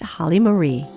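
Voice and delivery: normal talking voice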